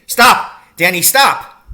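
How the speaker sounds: loud, high, urgent yell; shouted warning. A narrator's reading. Father stops his son short of danger, shouting. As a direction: halt someone right in front of you. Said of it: "he shouted".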